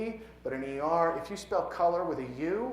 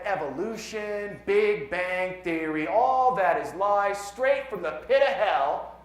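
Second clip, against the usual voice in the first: loud, slow, higher-pitched pronouncement; fiery denunciation; 5 semitones higher, 8 dB louder, slower than usual. Acted. Poet quotes a congressman condemning science, loud fiery denunciation. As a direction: thunder it out, stretching the words.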